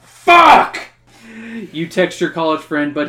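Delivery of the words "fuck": loud high curse; frustrated shout. Acted. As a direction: yell it in frustration. Flight canceled, panic.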